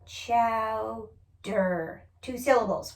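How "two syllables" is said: ordinary talk, nothing marked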